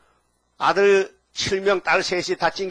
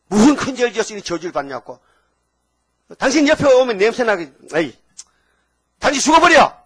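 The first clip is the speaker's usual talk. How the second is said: angry wife; 4 semitones higher, 7 dB louder than usual. Acted. Job's wife berating him.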